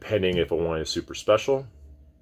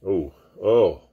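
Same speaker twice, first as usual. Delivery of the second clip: disgusted groan; 3 semitones higher, 6 dB louder than usual. Real, not acted. Smelling the corked wine again.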